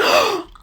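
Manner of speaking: sharp, high, offended intake of breath; a hammed-up horrified gasp; mock shocked gasp. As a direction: gasp like a scandalized onlooker. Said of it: "everybody's like".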